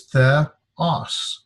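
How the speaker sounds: theos split as the, pause, os; separated vowels. Shown as a demonstration. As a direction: say theos slowly in two syllables. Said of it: "we would separate the two vowels".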